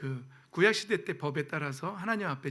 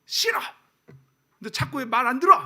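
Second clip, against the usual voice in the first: scolding parent; 7 semitones higher, 8 dB louder than usual. Retold or imitated. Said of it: "막 이러면서".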